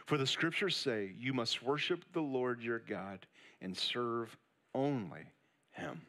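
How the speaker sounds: hushed scripture reading before the sermon. Acted. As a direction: read the verse quietly and reverently.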